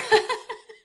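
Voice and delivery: high, teary burst of laughter. Real, not acted. Host moved by the angel message, teary laugh. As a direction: laugh through welling tears. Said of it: "Making me tear up over here".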